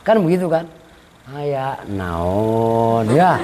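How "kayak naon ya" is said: slow, low, drawn-out mock Sundanese "Kayak naon ya"; Sundanese accent mocked. Preacher mimics a Cianjur Sundanese speaker in a slow, low mock accent. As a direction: drag it out like a faint, hungry Cianjur speaker. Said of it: "kayak orang kelaparan begitu".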